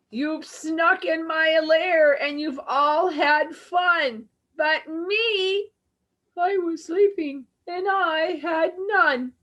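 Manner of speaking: high, whiny crying bear; sad bear whine. Acted. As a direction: wail it like a hurt big bear. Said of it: "And he whispers and he moans and he wails".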